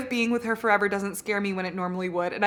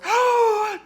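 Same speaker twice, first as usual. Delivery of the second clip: loud, high gasp; high fangirl gasp; swooning gasp; 13 semitones higher, 5 dB louder than usual. Real, not acted. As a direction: gasp in overwhelmed delight. Swooning over the book's hero.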